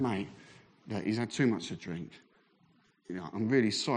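low, soft retold apology; smooth it over quietly; placating apology. Friend apologising to calm things.

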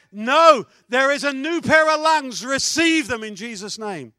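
loud, forceful faith declaration; commanding proclamation. Acted. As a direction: command it over her with authority. Preacher commanding healing loudly.

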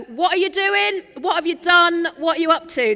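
put-on half-shouted parent checking on kids; suspicious parent. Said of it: "You go".

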